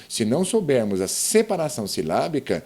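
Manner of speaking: usual speaking voice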